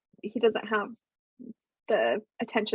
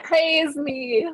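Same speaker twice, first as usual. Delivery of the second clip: mock craving praise; 4 semitones higher, 7 dB louder than usual. Mocking own craving for attention.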